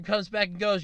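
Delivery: normal talking voice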